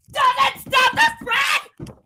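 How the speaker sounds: sudden high, slow, hammed-up cry of outrage; mock emotional outburst. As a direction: overplay the emotion, stretch each word out. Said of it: "Sorry, I'm not supposed to get emotional".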